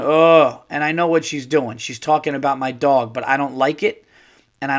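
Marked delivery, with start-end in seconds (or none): 0.0-0.6 loud groan. Retold or imitated. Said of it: "she starts going".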